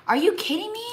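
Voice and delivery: high, incredulous squeak; mock disbelief. Retelling her reply to her husband, high mock disbelief. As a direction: act shocked at the very idea. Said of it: "And I'm like".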